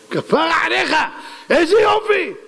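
high-pitched acted delight; delighted praise. King praising the advisor who saved his son, delighted. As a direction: exclaim it high, overjoyed.

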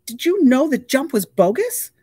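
high, loud angry demand. A narrator's reading. Tuna angrily demanding an answer from Wahoo. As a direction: confront him. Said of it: "Tuna demanded of Wahoo".

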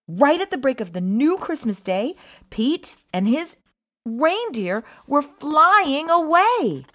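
usual speaking voice

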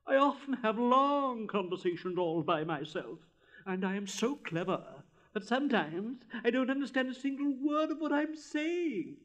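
lofty, self-important pronouncement; haughty rocket. Acted. Rocket whirling through the air, haughtily insisting.

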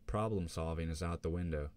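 plain reading voice

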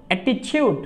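অ্যাটিটিউড with the tu turned into চু; t like Bengali চ. Shown as a demonstration. Teacher repeats the word for the class, careful pron. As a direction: say অ্যাটিটিউড, t softened to চ. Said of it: "এই টি এর উচ্চারণ হবে চর মতো".

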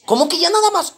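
high-pitched, baffled challenge; incredulous question. Acted. Policía incrédulo cuestiona su respuesta. (incrédulo, con la voz aguda)

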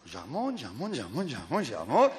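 low, soft repeated chant; hushed, cyclical sing-song; careful reeling in. Acted. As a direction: murmur it in a rolling rhythm, like winding a reel. Acts out reeling in a catch.

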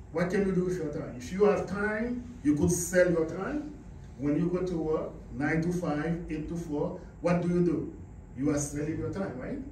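ordinary talk, nothing marked

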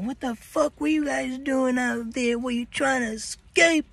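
high, slow suspicious guard voice; accusing guard. Retold or imitated. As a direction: grill the inmates like a CO. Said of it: "they're like".

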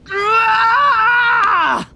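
high, drawn-out "aah" yell; sudden cry. Acted. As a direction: cry out in one long high burst. Hero cries out suddenly.

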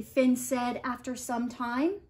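plain reading voice

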